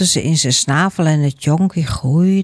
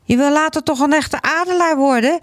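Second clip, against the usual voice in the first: high, calling voice from above; urgent call; 11 semitones higher than usual. A narrator's reading. Father eagle to his falling young, urgent calling. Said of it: "hoorde hij de stem boven zich".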